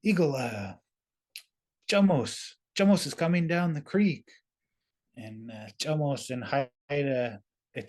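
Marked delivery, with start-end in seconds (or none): 0.0-4.3 raised-pitch, urgent warning cry; lightly acted alarm, breaking off between names; mock frantic. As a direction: call out a pretend warning in a frenzy. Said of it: "he looks all frantic".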